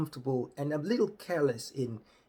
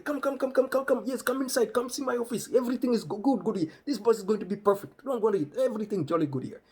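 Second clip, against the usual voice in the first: much higher, louder, excited calling; over-eager Rajiv; 10 semitones higher, 5 dB louder, faster than usual. Retold or imitated. Rajiv calling the bus in from the street, high and loud. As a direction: hype guy waving the bus in. Rajiv ushers them inside.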